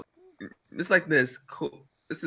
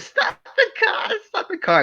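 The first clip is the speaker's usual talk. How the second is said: high panicked yell; 3 dB louder than usual. Retold or imitated. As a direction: squeal it like a panicked kid. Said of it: "He said".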